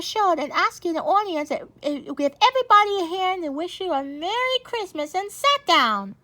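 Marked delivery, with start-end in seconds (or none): none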